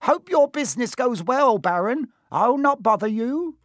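high, loud parting flattery; bow out sweetly; fawning poltergeist. Acted. Peeves leaves flattering.

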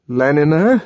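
rising, uneasy question on Leniner; apprehensive. A narrator's reading. John repeating Leniner as she undresses, apprehensive. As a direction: ask Leniner warily, dreading the answer. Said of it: "he repeated apprehensively".

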